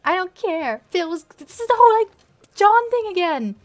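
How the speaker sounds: loud, high, desperate cry; hammed-up despairing yell; distraught shout. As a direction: shout it through grief. Grieving, shouting.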